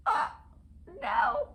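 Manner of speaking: sudden high, loud cry of dismay. Real, not acted. Vlogger smudging her painted toenails, dismayed yelp. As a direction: yelp as the wet polish smudges. Smudged wet nail polish.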